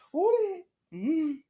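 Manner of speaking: normal talking voice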